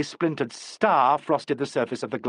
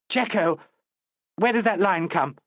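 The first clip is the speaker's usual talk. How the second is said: alarmed question; 6 semitones higher than usual. A narrator's reading. Panicking actress, alarmed.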